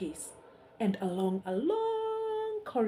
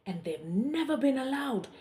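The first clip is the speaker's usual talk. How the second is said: hammed-up sobbing king; 5 semitones higher, 4 dB louder than usual. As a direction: blubber through the words. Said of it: "he sobbed".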